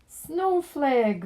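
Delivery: high, loud cry of delight; girl finding her lost puppy, thrilled; joyful exclamation. A narrator's reading. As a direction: shout the puppy's name with relief. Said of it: "Veronica exclaimed".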